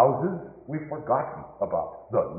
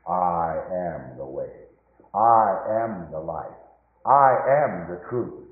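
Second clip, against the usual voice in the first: slow, deliberate words of Jesus; gentle solemn declaration; solemn slow answer; 5 semitones lower, 4 dB louder, slower than usual. Acted. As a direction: let each I am land calmly. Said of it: "came the splendid answer".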